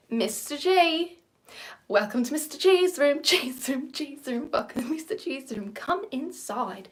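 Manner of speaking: put-on high, playful Australian teacher accent; Mister G impression. Vlogger showing off her Australian teacher voice. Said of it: "Mister J character from Summer Heights High".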